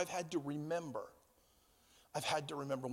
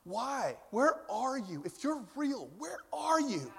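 anguished, high-pitched plea; crying out to God; 4 semitones higher than usual. Acted. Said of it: "started to cry out to God".

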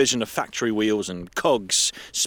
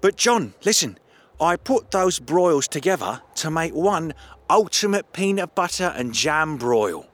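higher, louder eager voice; excited; 8 semitones higher, 4 dB louder than usual. Acted. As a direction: push on eagerly. Alex pushes the joke.

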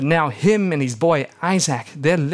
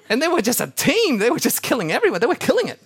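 excited retelling; 10 semitones higher, 3 dB louder, faster than usual. Real, not acted. Excited retelling of Gladiator fight.

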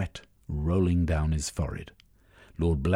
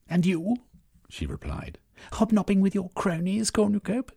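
much higher, sharp mocking woman's voice; scornful wife; 13 semitones higher than usual. A narrator's reading. Estacia to her husband Cornucope, high scornful jab. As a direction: needle him like a displeased wife.

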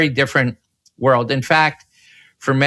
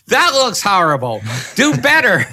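high, louder, laughing mock scold; mock scolding editor; 7 semitones higher, 3 dB louder than usual. Editor ordering the cameraman to do better, high laughing mock scold. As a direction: ham up a bossy editor, pitched up. Editor's blunt verdict retold.

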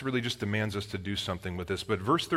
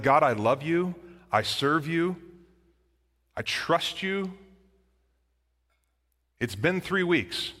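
slow, higher, halting plea to God; pleading prayer; 5 semitones higher, slower than usual. Acted. Believer questioning God after weeks of waiting, pleading. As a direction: plead upward, pausing long between phrases.

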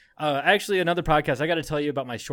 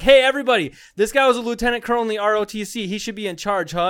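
higher and louder, sarcastic call to the room; mocking announcement; 7 semitones higher, 8 dB louder, faster than usual. Mocking the recruit in front of everyone, sarcastic shout. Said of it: "I was like".

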